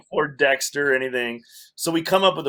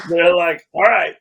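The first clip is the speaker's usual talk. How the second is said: laughing; 5 semitones higher, 9 dB louder than usual. Real, not acted. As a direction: still laughing, louder. Laughter carrying into line.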